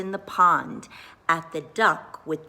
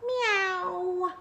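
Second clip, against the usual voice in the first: high cat meow; 11 semitones higher, 4 dB quieter than usual. A narrator's reading. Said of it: "let out a".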